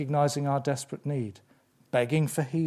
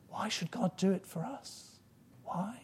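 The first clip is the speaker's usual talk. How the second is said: high, soft, wondering question; hushed wonder; 6 semitones higher, 8 dB quieter than usual. Real, not acted. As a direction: drop to a hushed, higher voice in wonder. Preacher marvels at undeserved grace.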